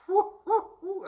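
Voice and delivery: high, loud whooping; excited whoops. Real, not acted. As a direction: hoot with delight. Excited hoots about the movie.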